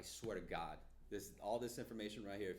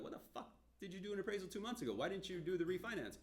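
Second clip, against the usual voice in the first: higher, fast incredulous challenge; higher, fast, mock outraged loan officer; mock disbelief; 10 semitones higher, 3 dB louder than usual. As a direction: fire off the question in mock disbelief. Mock questioning the borrower.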